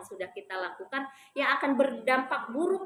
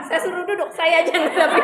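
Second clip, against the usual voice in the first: a louder laughing punchline; 4 semitones higher, 7 dB louder than usual. Real, not acted. Telling mothers about her husband, laughing. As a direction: laugh as you deliver the line.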